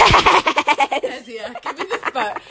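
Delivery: laughing, bossy mum voice; imitating mum. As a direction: mimic her mother mid-laugh. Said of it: "she says".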